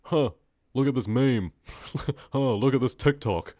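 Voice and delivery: mock phone scroller. Streamer mocking people glued to phones, dopey mock amusement. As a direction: ham up a mindless scroller.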